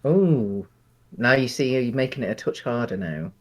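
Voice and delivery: normal talking voice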